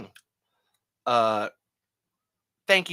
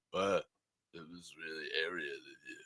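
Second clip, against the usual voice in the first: Stallone impression; 8 semitones lower, 12 dB quieter than usual. Stallone phoning the podcast hosts, in a lower Stallone voice. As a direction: do Sylvester Stallone confessing to the hosts. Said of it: "I was trying to do Stallone".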